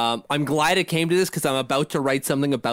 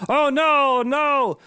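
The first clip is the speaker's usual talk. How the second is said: high-pitched wail of dismay; hammed-up "oh no" panic; mock dismay; 11 semitones higher, 3 dB louder, much slower than usual. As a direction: imitate distraught fans, high and drawn out. Imitates upset fans' reaction.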